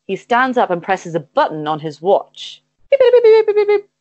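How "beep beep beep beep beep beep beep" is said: very high, rapid electronic beeps; beeping sound effect. Acted. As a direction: imitate a watch button's shrill alarm. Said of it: "I'm bad at sound effects".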